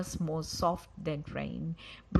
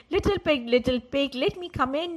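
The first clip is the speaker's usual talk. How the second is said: high, loud wolf call at the door; 8 semitones higher, 7 dB louder than usual. Acted. Wolf calling to the pig in the twig house, mock sweet. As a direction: coax the pig out, hammed up. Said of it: "The wolf said".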